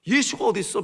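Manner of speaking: loud, high, defiant mock cry; reenacted teenage indignation; angry outburst. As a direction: throw out an angry challenge. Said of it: "막 화를 내는 거예요".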